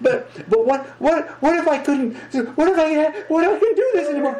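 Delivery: high, fast, laughing mock worry; mock panic. A laughing mortal panicking on the tightrope, in mock high-pitched fear.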